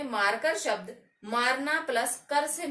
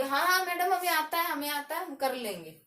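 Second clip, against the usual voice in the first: higher-pitched pupils chiming in, settling at the end; imitated eager students; 5 semitones higher, faster than usual. Teacher mimicking pupils who say they can manage, eager students. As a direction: rattle off a pupil's confident assurance, starting high. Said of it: "तो आप कहोगे".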